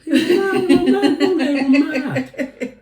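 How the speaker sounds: high laughing protest; cracking up as he corrects himself. Real, not acted. Laughing while explaining the joke to his passenger.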